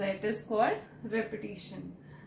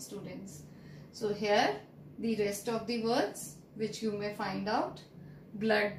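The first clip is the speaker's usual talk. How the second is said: ordinary talk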